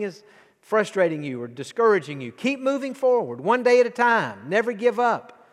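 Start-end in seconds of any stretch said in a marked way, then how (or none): 2.3-5.5 higher and louder, building preacher's cry; preacher rousing the congregation, loud and high; rising preaching. Acted. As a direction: lift it up like a rallying call. Preacher's rising closing exhortation.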